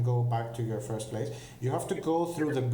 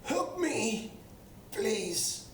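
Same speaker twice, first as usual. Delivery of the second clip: much higher, desperate plea; captive crying for help; 11 semitones higher, 4 dB quieter, much slower than usual. Acted. Captive human calling to rescuers, high frightened plea. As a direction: beg for rescue from far off. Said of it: "a male shouting out".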